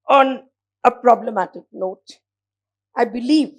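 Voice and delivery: normal talking voice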